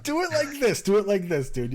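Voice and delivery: ordinary talk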